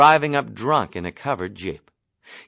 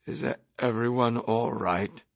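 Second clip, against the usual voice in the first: softer, hushed question; 5 dB quieter than usual. Acted. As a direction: ask it in a hush after the crash. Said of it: "inquired in a hushed voice".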